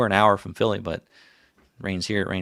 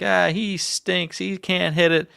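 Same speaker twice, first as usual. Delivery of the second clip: put-on taunting kids; 9 semitones higher, slower than usual. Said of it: "They're like".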